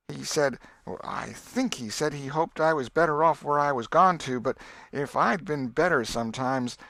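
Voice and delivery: put-on a boy slyly quoting his brother; boy Tom voiced. Tom recounts Sid.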